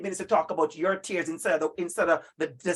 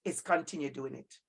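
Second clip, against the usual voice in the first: soft urging; 8 semitones lower, 10 dB quieter than usual. Real, not acted.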